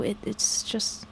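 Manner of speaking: faltering, slow whisper. Acted. Boy falters before admitting the truth, whispering. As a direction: breathe the words, barely there. Hesitant confession to worried adult.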